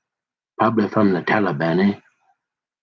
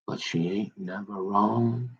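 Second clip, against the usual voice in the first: slow and much softer, drawing it out; hushed, knowing proverb delivery; knowing folk saying; 10 dB quieter, slower than usual. Retold or imitated. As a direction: let mama's wisdom settle slowly. Quoting mama's saying, drawn out.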